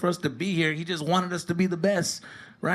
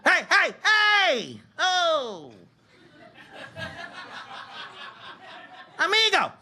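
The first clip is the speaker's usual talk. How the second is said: referee shouting; 7 semitones higher than usual. Retold or imitated. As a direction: bellow the warning like a ref taking control. Ref kicking dad out.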